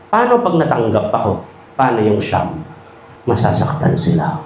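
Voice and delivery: low, subdued mock gravity; mock guilt trip. Speaker blames the best applicant, mock-grave. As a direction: drop the voice low to lay on guilt.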